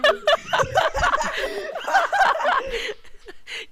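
squealing high laughter; high shrieking laugh. Real, not acted. Hysterical laughter at TJ's text.

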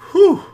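sharp, high, loud whew of relief; relieved exhale. A narrator's reading. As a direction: blow out the tension all at once. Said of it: "I exhaled".

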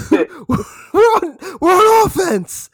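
high, loud, stretched mock voice of Voodoo; hammed-up imitation of a teammate. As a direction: squeal it like a clueless teammate. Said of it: "And then he goes".

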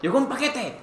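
llamando fuerte y agudo a Clau. Retold or imitated. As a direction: grita el aviso hacia el cuarto. Despierta a Clau. (despierta a Clau con un llamado agudo)

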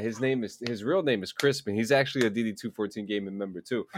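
ordinary talk, nothing marked

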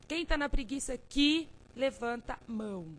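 chamando o público bem mais alto; chamado mais alto. Shown as a demonstration. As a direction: convoque a plateia com força. ("Quem tá na preguiça aqui" bem mais alto)

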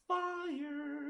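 squeaky high exclamation; high squeal. Real, not acted. Hyping the memes.

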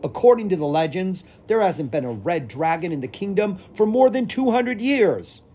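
loud, high-pitched royal protest; bellowing. A narrator's reading. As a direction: bellow it in disbelief. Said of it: "the king bellowed".

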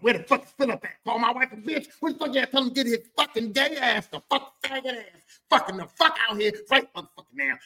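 high-pitched profane rant; acted furious tirade; raging dad. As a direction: spit out demands like an enraged husband. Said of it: "That's how my dad was".

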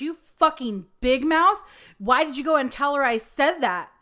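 loud, high retold yell at a coworker; doctor blowing up at the nurse who made the call. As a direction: bark it like a furious boss. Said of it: "after doctor yelled at me".

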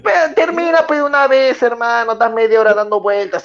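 exasperated. Real, not acted. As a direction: demand he finish, losing patience. Heated on-air argument between pundits. (exasperado, mucho más agudo y más fuerte)

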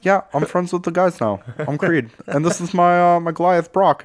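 high, dopey bro voice; goofy high voice. Retold or imitated. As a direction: play a clueless newcomer. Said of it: "That's not his voice".

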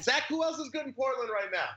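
shouting to the next room; calling out. Real, not acted. Calling to roommate in other room.

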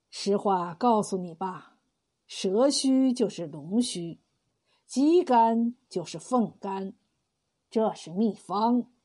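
put-on slow, low old man confiding a secret; old herbalist telling the rich man the secret, slow and low. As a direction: lean in and let the old man reveal it slowly.

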